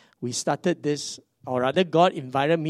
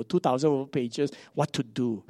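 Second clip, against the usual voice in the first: usual speaking voice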